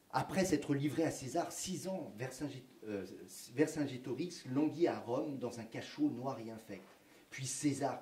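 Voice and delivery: put-on reads the old schoolbook text with measured solemn pacing; reading aloud. History teacher reads textbook passage to students, reading aloud. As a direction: recite it like a 1905 school reader.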